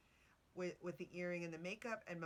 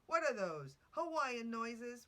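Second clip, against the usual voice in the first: quoted delivery man; 7 semitones higher, 8 dB louder, slower than usual. Retold or imitated.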